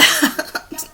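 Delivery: short breathy laugh at oneself; quick self-mocking chuckle; amused chuckle. Real, not acted. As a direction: laugh off your own blunder. Laughing at own recording mistake.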